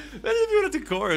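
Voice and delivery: words squeezed out through laughter, high and fast; giggling disbelief at the thought; laughing. Real, not acted. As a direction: laugh while getting it out.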